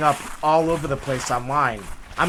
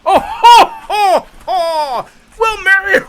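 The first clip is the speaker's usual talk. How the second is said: high booming ho ho ho; jolly Santa voice; 9 dB louder, slower than usual. Retold or imitated. Retaking the Santa video, booming jolly Santa. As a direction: start the Santa take again. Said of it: "I'm like".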